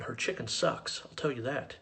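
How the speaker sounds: ordinary talk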